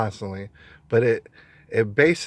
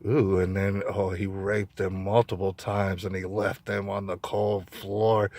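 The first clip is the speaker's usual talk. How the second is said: slow, softer ghoulish narration; creepy crime-show narrator; 4 semitones lower, 5 dB quieter than usual. Retold or imitated. Vlogger imitating morning true-crime TV, slow and softer. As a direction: murmur the gore like a morbid host. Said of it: "I swear this is what the shows are saying".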